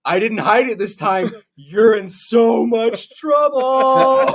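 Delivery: high, slow, teasing dad voice; smug dad who caught his son swearing; gloating dad. Retold or imitated. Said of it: "He goes".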